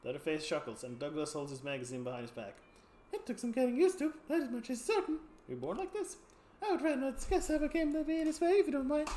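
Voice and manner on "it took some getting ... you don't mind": high, cheerful freak voice with a laugh; two-faced sideshow freak. Acted. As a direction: play the odd two-faced performer, amused. Sideshow freak answering player.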